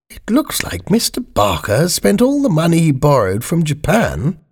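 high-pitched Queen, thinking to herself; high royal musing. Acted. Voices the Queen's thought.

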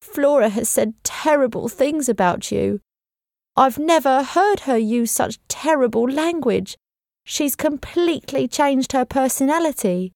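housekeeper, mildly voiced and tearful; upset housekeeper. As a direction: lift the voice a little, anxious and tearful. Mrs Groves voiced, upset.

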